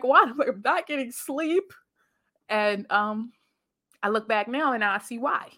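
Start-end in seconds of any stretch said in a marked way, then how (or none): 0.0-1.7 whiny question. Retold or imitated. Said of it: "I was like".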